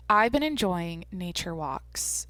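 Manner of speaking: slow, careful delivery; deliberately slowed-down vlog talk. Real, not acted. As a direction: stretch it out deliberately. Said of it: "I'm trying to go slower".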